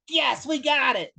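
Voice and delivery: high, slow, drawn-out triumphant yes; gleeful cheer. Retold or imitated. Director to crew after the punch, gleeful cheer. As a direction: crow over the perfect take.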